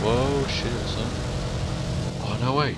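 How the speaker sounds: real surprise. Real, not acted. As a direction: caught off guard by something unexpected in the game. Surprised by a move in game.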